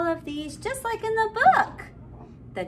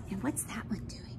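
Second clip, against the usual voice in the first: hushed storybook whisper; 8 semitones lower, 14 dB quieter, faster than usual. Pretend. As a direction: lean in and whisper it. Secretive aside while reading.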